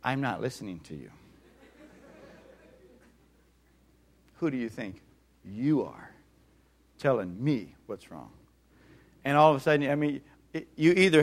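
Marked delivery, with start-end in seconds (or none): 0.0-8.3 low, soft, mock-defiant voicing of a face; dismissive inner voice. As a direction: let the face talk back quietly and scornfully. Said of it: "their face is saying".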